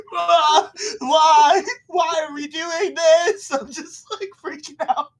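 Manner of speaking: high laughing cry of disbelief; laughing shriek. Real, not acted. Player shrieking at gross scene.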